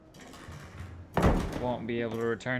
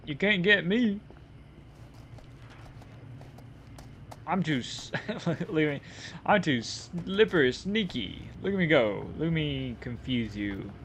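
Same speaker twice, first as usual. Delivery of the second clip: playful taunting; 5 semitones higher than usual. Pretend. Taunting the chasing police.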